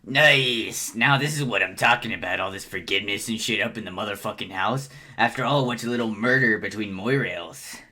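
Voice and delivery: loud, approving preacher character; Gamzee. Acted. Clown preacher celebrates forgiveness between friends, booming character voice. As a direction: proclaim forgiveness to the flock. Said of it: "Gamzee's voice is the funnest thing".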